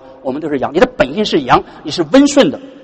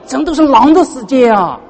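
真的是狼的世界啊 drawn out slow and higher, a mock wail; imitating a lamenting member; 6 semitones higher than usual. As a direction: whine it like a put-upon churchgoer. Voices complaining church member.

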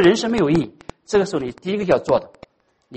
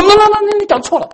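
loud, higher, cutting-off no no no; interrupting correction; 8 dB louder than usual. Pretend. Hypothetical God cutting Elijah off, a loud high interruption. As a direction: shout him down mid-sentence. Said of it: "上帝没有停止他".

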